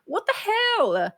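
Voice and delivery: high, loud startled yelps; shocked at being hit; startled outcry. Real, not acted. Being pelted by Waddles.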